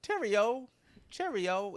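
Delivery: high mock British cheerio. Trying another accent for his cohost, mock British cheerio. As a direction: toss off a stagey cheerio. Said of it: "Is that Ireland?".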